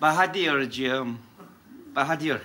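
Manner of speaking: low, quiet coaxing of the name; hushed, low-pitched appeal; soft address. Acted. Softly addressing the janitor afterwards.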